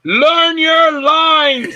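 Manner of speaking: very high, loud drawn-out holler; imitated yell. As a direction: bellow it slowly down the phone, imitating an exasperated director. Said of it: "I don't mean to yell at you".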